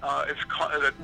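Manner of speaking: normal talking voice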